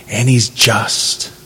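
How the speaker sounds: "just" drawn out slowly and softly as the key word; stress on just. Shown as a demonstration. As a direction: lean long on "just". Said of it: "The emphasis on just is".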